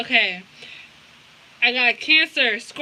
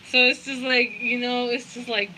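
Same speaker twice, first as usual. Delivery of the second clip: ordinary talk, nothing marked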